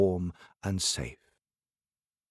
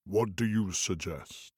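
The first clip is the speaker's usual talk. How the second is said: low, slow booming giant; 3 semitones lower, 4 dB quieter than usual. Acted. Giant woken from sleep, slow deep rumble. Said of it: "giant voice".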